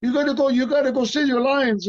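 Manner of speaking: high, excited French impression; Jean-Claude. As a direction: imitate an excited Frenchman rattling on. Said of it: "he was all excited".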